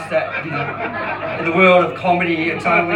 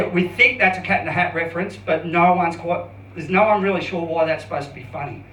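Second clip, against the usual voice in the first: ordinary talk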